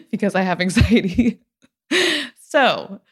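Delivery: laughing it off while speaking; a self-mocking little laugh through the words. Real, not acted. Laughs about own anxiety.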